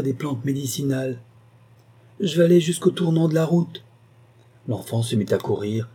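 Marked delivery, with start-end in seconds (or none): 2.2-3.8 put-on a keen little boy calling out; child's voice. Child speaks.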